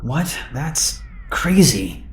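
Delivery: slow, soft, drowsy mumble; barely awake and groggy; half asleep. Retold or imitated. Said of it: "still half asleep".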